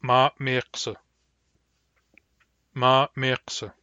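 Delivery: glottalized m in "Ma miqsa"; hard M. Shown as a demonstration. Teacher giving the hard M example, glottalized "Ma miqsa". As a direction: cut "Ma miqsa" with a glottal catch before the second m. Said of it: "Hard M".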